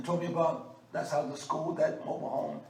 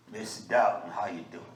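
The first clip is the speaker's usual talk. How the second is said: country greeting; 10 semitones lower than usual. Shown as a demonstration. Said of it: "that's the way I told him".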